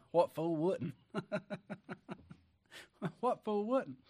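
real laughter breaking around the words; laughing. Real, not acted. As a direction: say it through a chuckle, pitch rising. Preacher laughs at his own question.

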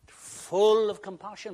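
loud, high and slow proclamation; booming slow "full of compassion"; preaching shout. Acted. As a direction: ring it out to the room. Preacher drives home the point.

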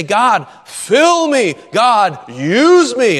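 loud, high, drawn-out plea to God; fervent mock prayer. Congregant begging God at the altar, mock fervent plea. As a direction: cry out like an over-earnest worshipper.

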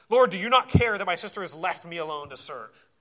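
loud, accusing plea to the Lord; retold angry outburst, loud; angry demand. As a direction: hurl the complaint at him. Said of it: "there's some anger in that".

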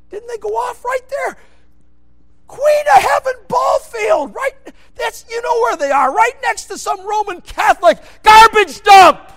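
loud, high full-force sermon shouting; fiery preaching. Acted. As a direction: thunder it from the pulpit.

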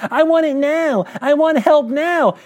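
high, whining mock voice; hammed-up impatient believer; whiny demand. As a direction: mimic a spoiled child wanting it now. Mimicking lazy Christians demanding.

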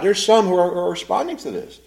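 normal talking voice

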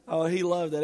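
ordinary talk, nothing marked

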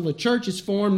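ordinary talk

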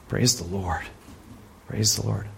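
low, soft reverent praise; hushed praise. Real, not acted. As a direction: murmur it in awe. Quiet awed praise.

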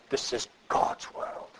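soft unvoiced whisper of the quote. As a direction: breathe it out as a hushed whisper. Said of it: "I'm not gonna try to do it".

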